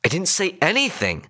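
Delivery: high-pitched, defensive denial; defensive swearing. A narrator's reading. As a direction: protest your innocence. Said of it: "the man swore".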